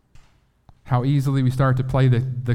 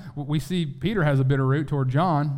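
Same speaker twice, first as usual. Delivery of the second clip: normal talking voice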